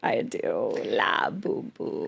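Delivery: low, slow croaky grandma. Pretend. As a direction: croak it like an old granny. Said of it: "the grandma voice".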